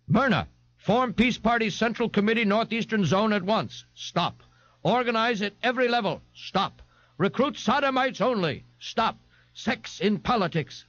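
high, staccato telegram dictation; telegram read-out. Acted. Myrna reading Ignatius's telegram back, high staccato read-out.

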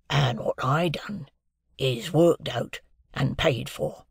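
a lightly voiced, hushed old convict; Magwitch the convict. A narrator's reading. Convict answering Pip at breakfast, lightly voiced and hushed. Convict figure in read novel.

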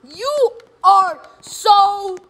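high, slow, drawn-out threat; indignant blame. Real, not acted. Player blaming another for their death, indignant. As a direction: stretch each word in high outrage.

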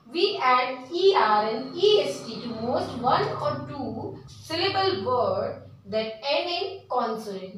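reads the rule very slowly, word by word; slow dictation. Shown as a demonstration. Teacher dictating a grammar rule to students, very slow.

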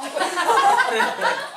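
high loud laugh; burst of laughter. Real, not acted. (éclat de rire)

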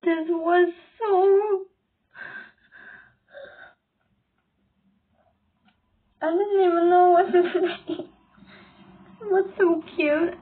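a teary catch in the throat; holding back real tears; tearful. Real, not acted. Gushing over a cute performance, tearful. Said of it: "I'm crying".

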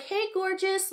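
high, syrupy mock greeting; mock sugary aunt. Mocking the aunt's DM in a high sugary voice. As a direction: overdo the fake-friendly hello. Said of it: "Just like".

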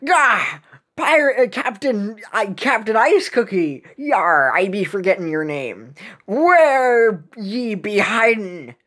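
exclaimed, hammed-up pirate challenge; riled pirate captain. Pirate challenging Captain Ice Cookie, riled pirate voice.

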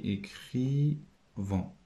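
"écrivons" very slow and soft, syllables apart; syllable-by-syllable sounding out. Shown as a demonstration. Teacher writes the word for pupils, sounding it out slowly. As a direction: stretch out each syllable of "écrivons".

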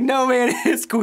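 high, loud, laughing pastor reassuring the embarrassed worker; laughing reassurance. Retold or imitated. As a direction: laugh through a high, easygoing "it's cool". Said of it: "I'm like".

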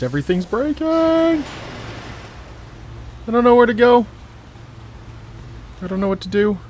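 freaking out, no idea where to go; panicking gamer. Real, not acted. Everything collapsing, lost.

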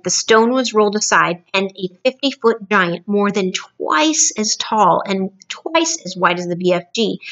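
plain reading voice

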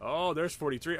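ordinary delivery

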